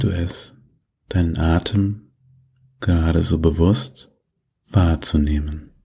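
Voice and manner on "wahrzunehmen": normal talking voice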